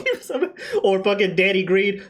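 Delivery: normal talking voice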